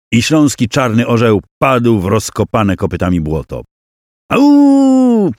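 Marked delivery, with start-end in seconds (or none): 4.3-5.3 sharp, very high, louder cry of dismay; pained cry. Acted. Both armies see the eagle fall, pained cry. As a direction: let out a stricken cry as the banner falls. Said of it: "wydobyło się z piersi po obu stronach".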